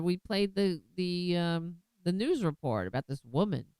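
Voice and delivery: ordinary talk, nothing marked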